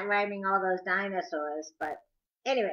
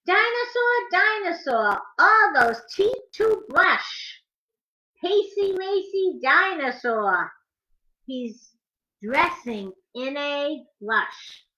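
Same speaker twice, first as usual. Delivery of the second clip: lively, sing-song picture book rhyme, higher; reading to children; 7 semitones higher, 6 dB louder, slower than usual. Acted. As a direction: bounce the rhymes for little listeners.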